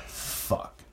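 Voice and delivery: hushed, unvoiced curse as the cops appear; whispered dread at the police lights. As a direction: breathe the curse under your breath. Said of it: "And I'm like".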